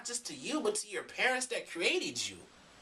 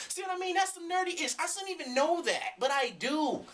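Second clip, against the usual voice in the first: nerdy glee; 9 semitones higher than usual. Real, not acted. Excited nerd laughing at himself.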